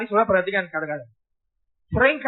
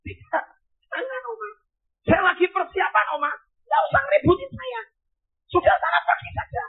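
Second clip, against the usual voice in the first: irritated refusal. Retold or imitated. Busy pastor waving off the old woman, annoyed and high. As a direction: shoo her away impatiently.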